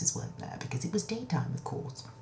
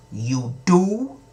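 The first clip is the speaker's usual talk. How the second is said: high, loud disbelieving question; surprised king; 5 semitones higher, 8 dB louder than usual. Acted. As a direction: react like a king caught off guard. King surprised by soldier's answer.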